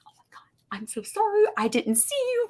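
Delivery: flustered high whisper, mortified; embarrassed whispered apology. Retold or imitated. Apologizing to the grumpy clerk she startled, hushed and high. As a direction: gasp out the sorry in a hushed, high voice.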